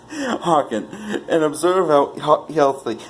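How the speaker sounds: laughing through the word; a giggling madman beckons the listener. Acted. Narrator chuckles as he calls his listener to hear.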